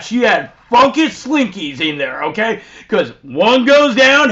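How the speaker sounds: usual speaking voice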